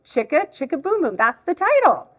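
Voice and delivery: high, animated read-aloud voice; reading to a child. Shown as a demonstration. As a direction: show a picture book to a little one. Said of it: "read it like I would if a child is here".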